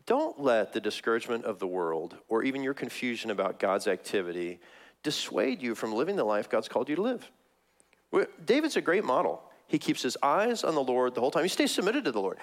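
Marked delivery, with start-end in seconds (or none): none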